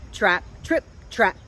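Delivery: usual speaking voice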